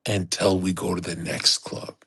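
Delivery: dramatic unvoiced whisper. Real, not acted. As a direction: lean in and whisper the twist. Dramatic build-up in story.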